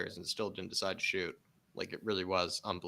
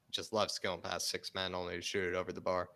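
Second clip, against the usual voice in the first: ordinary talk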